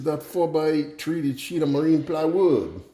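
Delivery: put-on slow, high-pitched Cajun accent; Justin Wilson. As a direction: proclaim it slowly in a Cajun joke voice. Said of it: "do the Justin Wilson thing and tell a joke".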